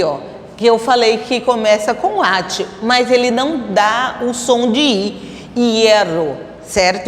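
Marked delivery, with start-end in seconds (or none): none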